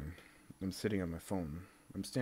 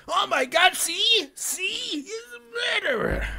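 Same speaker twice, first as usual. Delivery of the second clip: much higher, louder, a crying shout; hysterical mock shriek; 10 dB louder, slower than usual. The internet accusing him, a high hysterical shriek.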